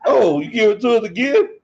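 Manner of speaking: loud, high-pitched disbelief; mock surprise. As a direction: play up the incredulous question, loud. Mimics opponents getting ball back.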